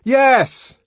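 high, loud, blunt yes; exasperated shout; shouted confirmation. A narrator's reading. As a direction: yell it back through the door. Shouted answer through door.

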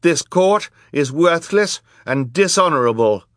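put-on high, loud, indignant girl's voice; fierce defiance. A narrator's reading. Girl denouncing the corrupt court, fierce and loud. Violet defies the villainous judges.

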